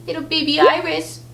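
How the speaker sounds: put-on cooing baby talk. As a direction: coo it like talking to a baby. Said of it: "do the baby voice".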